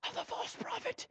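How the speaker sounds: breathy hushed whisper after the shout; sudden whisper. Acted. Preacher hushing the congregation, whispered ending. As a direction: drop it to a secret whisper.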